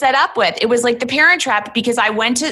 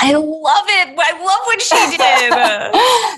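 higher, slow, drawn-out delight; 7 semitones higher, slower than usual. Real, not acted. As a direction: savour it, thrilled by the daughter's nerve. S1 delighted by the story.

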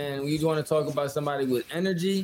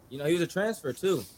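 ordinary talk

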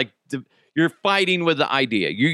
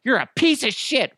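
high-pitched mock-furious yell; pissed-off girlfriend; 13 semitones higher than usual. Stomach as girlfriend throwing everything out, shrieky mock rage. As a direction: hurl insults like a hammed-up angry ex. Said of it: "my stomach is like the pissed off girlfriend".

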